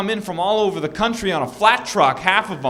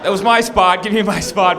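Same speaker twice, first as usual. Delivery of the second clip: louder, mock-indignant claim; acted-out raised-voice insistence; insistent demand; 3 semitones higher, 5 dB louder than usual. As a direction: press the demand in a quarrel. Quarrel over parking spot escalates.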